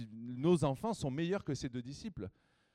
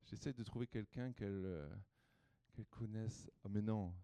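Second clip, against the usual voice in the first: quiet aside; 4 semitones lower, 8 dB quieter than usual. Real, not acted. Quiet aside while choosing someone.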